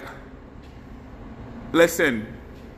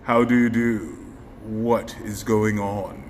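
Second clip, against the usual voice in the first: deep filter voice; 9 semitones lower, slower than usual. Acted. Trying bronze filter voice.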